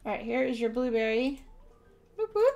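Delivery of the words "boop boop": high, loud playful boops; boop sounds. Pretend. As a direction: chirp it out like a toy.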